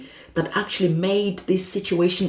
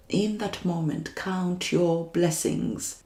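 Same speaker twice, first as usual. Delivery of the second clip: slow emphasis; slower than usual. Shown as a demonstration. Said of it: "repeated advice".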